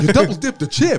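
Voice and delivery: quoting Seinfeld. Retold or imitated. Seinfeld quote.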